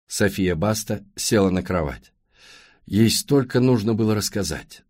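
plain reading voice

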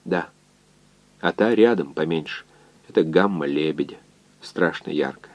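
hushed, understated exchange; half-whisper. A narrator's reading. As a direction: speak softly, as if under a night sky. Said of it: "перешли на полушёпот".

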